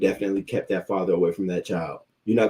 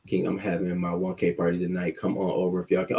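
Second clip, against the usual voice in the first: ordinary talk, nothing marked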